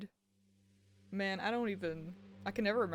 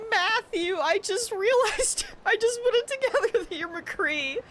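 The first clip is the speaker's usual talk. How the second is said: excited realization; 12 semitones higher, 8 dB louder than usual. Retold or imitated. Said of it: "he was like".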